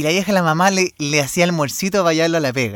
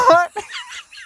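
high, wheezy laugh; punchline laugh. Real, not acted. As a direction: burst out laughing high at own story. (risa aguda tras el remate)

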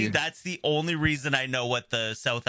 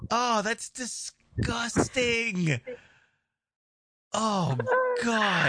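high, drawn-out revulsion; long, high groan of disgust; real disgust; 10 semitones higher, much slower than usual. Real, not acted. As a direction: wail it slowly, utterly grossed out. Revolted by fat and oatmeal.

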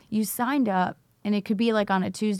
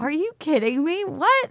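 higher, climbing mock disbelief; incredulous; 8 semitones higher, 3 dB louder than usual. Teacher retelling her reaction to her friend's idea, incredulous. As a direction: retell being floored, pitch leaping up on the last word. Retold shock at friend's big suggestion.

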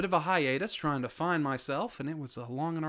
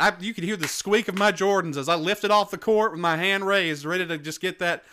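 loud, hammed-up complaining; mock outrage; 4 semitones higher, 7 dB louder than usual. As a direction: play up the betrayal, loud. Mock outrage, missed high five.

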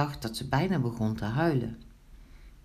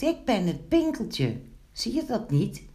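high, startled protest; alarmed; 7 semitones higher than usual. A narrator's reading. Gnome insists to Wiebelstaartje that it is him, alarmed. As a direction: insist in mild alarm, pitched up. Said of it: "riep Pinkeltje verschrikt".

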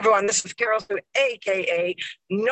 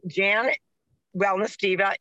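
ordinary delivery

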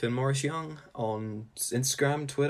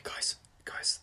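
hushed unvoiced whisper to the guys; 4 dB quieter than usual. Breaking the fourth wall, whispering to listeners. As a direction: lean in and whisper it. Speaker quotes self hushing crowd.

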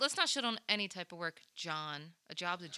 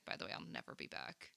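whispered aside; 11 semitones lower, 12 dB quieter than usual. Retold or imitated. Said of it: "I was like".

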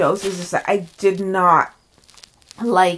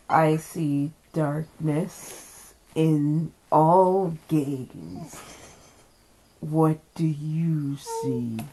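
lower, very slow spooky witch; creepy witch voice; 6 semitones lower, 7 dB quieter, much slower than usual. Acted. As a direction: drag it out like a dark witch. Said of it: "This is me".

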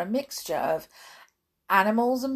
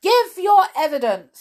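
loud, high royal demand; commanding king; 10 semitones higher, 12 dB louder than usual. A narrator's reading. King demanding evidence from the Hatter, loud command. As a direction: order the witness loudly. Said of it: "said the king".

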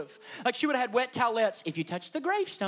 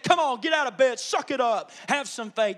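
loud, higher, brisk exhortation; unsympathetic pep talk; 4 semitones higher, 7 dB louder than usual. Acted. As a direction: push them impatiently to toughen up. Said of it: "That's not the tone".

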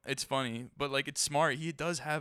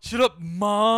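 slow, loud, higher bratty yell; mock bratty teen; 6 semitones higher, 11 dB louder, much slower than usual. Echoing his friend's bratty yell at mom, mocking.

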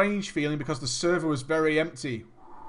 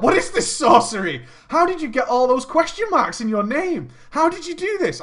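incredulous, swooping high pitch; dramatically baffled outburst; mock bewilderment; 5 semitones higher, 3 dB louder than usual. Acted bewildered reaction to odd name.

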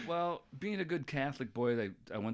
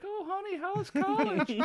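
high, slow sugary mom voice; doting mom; slower than usual. Retold or imitated. Parents greet their son at college, high and cooing. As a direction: coo it like a doting mother.

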